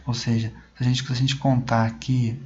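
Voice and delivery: ordinary talk, nothing marked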